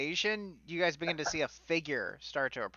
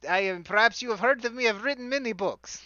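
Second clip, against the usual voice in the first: pompous wizard; 6 semitones higher than usual. Acted. GM continues as Volo.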